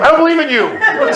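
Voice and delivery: high-pitched, indignant cry to God; escalated protest. Retold or imitated. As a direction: throw it upward in comic desperation.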